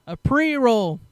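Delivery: loud, high-pitched incredulous repeat; exasperated outburst. Real, not acted. Storyteller stressing the tiny cause of the bust, exasperated outburst.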